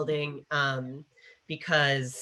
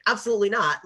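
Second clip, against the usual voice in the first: indignant refusal; 6 semitones higher, 6 dB louder, faster than usual. Retold or imitated. Voicing Lark's indignant refusal to sir.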